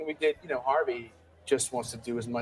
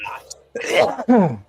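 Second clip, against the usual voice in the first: louder and slow, joking-threat punch; mock menacing boom; 10 semitones higher, 12 dB louder than usual. Producer threatening his writers, loud slow mock menace. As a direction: lean in hard on the fake joke. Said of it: "That's literally what it is".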